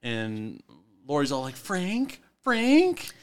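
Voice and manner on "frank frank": high, rising call of a name; questioning call. Acted. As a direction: call out searchingly, higher the second time. Character calls out for Frank.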